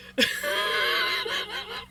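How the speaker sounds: high, breathless squealing laugh; helpless laugh. Real, not acted. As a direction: crack up in a long high laugh. Helpless laughing at the accent mix-up.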